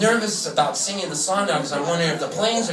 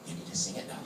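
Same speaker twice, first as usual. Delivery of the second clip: whispered echo; hushed and urgent; whispering the order; 15 dB quieter, much faster than usual. Retold or imitated. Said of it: "The girl from the Comcast goes".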